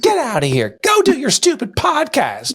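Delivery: high mock wife, laughing; imitating wife. Husband mimicking wife shooing him to his podcast. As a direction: toss it off like a teasing wife, slow and high. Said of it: "She's like".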